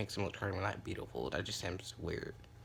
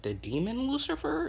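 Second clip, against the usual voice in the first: much higher, stunned question; shocked squeak; 4 dB louder than usual. Acted. Friends learning his grandfather is Lucifer, shocked high. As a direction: squeal it in disbelief.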